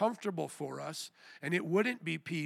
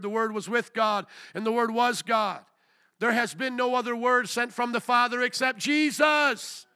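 loud, raised pulpit declaration; forceful preaching; 6 semitones higher, 8 dB louder than usual. Real, not acted. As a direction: build to a shout. Preaching scripture with rising force.

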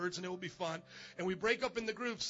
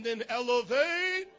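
drawn-out, louder hype announcement; rally speech; 3 semitones higher, 8 dB louder, slower than usual. Real, not acted. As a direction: build it up slow and big. Pastor announces youth group.

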